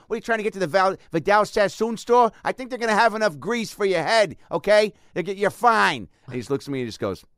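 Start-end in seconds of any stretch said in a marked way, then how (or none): 0.1-6.1 higher, louder hammed-up cop; mustache cop. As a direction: bark it like a cartoon traffic cop. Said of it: "this big mustache".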